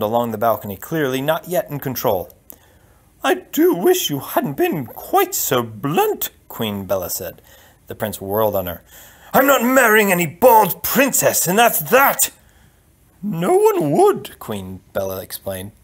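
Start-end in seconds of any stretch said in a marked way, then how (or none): 3.2-6.3 high, slow queenly voice; reproachful queen. Acted. Queen to her son after his outburst, high-pitched reproach. 9.3-12.3 loud, high, indignant outburst; angry prince. Acted. Prince to the queen, refusing the bald bride, angry shout. Prince turns on his mother. 13.2-14.4 very high, slow queen; queen's voice. Acted. Queen explaining to her furious son, high-pitched and slow.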